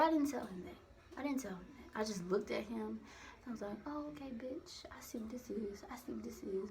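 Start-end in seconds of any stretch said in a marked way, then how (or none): 3.9-6.7 soft, under-the-breath knowing mutter; muttered realization. Retold or imitated. Looking at him without telling him, quiet knowing mutter.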